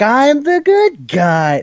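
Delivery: very high, drawn-out yell; mock yell. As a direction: imitate a hero yelling his one protest, stretched out. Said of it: "the only thing he yells at them".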